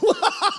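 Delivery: tawa lepas penuh sukacita; joyful laugh. Real, not acted. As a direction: burst of high joyful laughter. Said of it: "I start laughing out loud uncontrollably".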